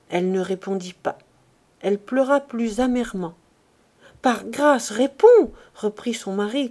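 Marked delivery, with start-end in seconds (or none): 4.2-5.5 rising, louder frightened plea; pleading in fear. A narrator's reading. Pierre begging his silent wife, frightened plea. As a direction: beg her to answer, scared. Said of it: "que ce muet désespoir épouvantait".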